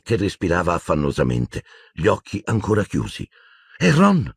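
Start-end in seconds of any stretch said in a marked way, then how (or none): none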